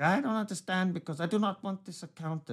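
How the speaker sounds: high, strained mock foreign caller; irate caller.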